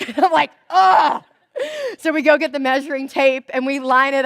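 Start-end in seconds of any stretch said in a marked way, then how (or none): 0.7-1.2 big frustrated ugh, higher and louder; loud exasperated groan. Retold or imitated. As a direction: let out a loud groan of defeat. Annoyed at being corrected.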